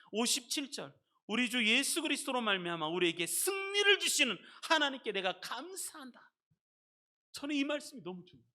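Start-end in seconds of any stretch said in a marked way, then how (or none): 1.3-6.3 high, loud proclamation of thanks; declaring scripture. Real, not acted. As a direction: declare it with full-voiced force. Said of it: "선포하듯이 이 말씀을". (선포하듯 힘차게)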